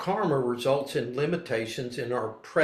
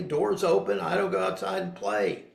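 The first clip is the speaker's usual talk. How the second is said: ordinary delivery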